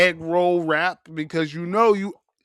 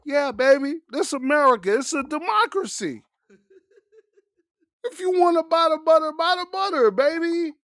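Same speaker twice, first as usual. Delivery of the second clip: hyped American; 10 semitones higher than usual. Pretend. Hyped reply to the tourist.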